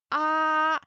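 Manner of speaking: "ah" held high, loud and level; high flat tone. Shown as a demonstration. Teacher reviewing first tone, high flat "ah". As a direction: sustain "ah" on one high pitch. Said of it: "high and flat".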